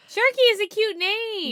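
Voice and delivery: delighted. Real, not acted. Delighted by nickname.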